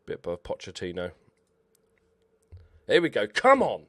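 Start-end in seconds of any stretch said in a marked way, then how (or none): 2.9-3.8 comedy angry. Pretend. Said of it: "I'm getting comedy angry now".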